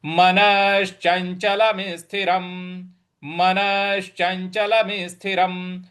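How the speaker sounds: मनश्चंचलम स्थिरम said with the म joined; Sanskrit recitation. Shown as a demonstration. Teacher modelling मनश्चंचलम स्थिरम for the class. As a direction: chant मनश्चंचलम स्थिरम syllable by syllable. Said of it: "इस प्रकार से बोलेंगे".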